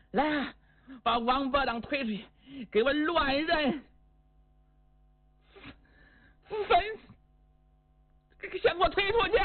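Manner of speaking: high-pitched, faltering order; furious command. Acted. Woman orders guards to take him out for execution, furious but wavering. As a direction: voice leaps up, breaking off between commands.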